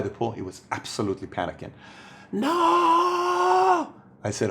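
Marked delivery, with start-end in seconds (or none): 2.3-3.3 put-on high, long child's wail of no; a toddler's high-pitched no, held long; imitating panicked toddler. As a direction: cry it out like a terrified little kid. Panicking toddler refusing the pool.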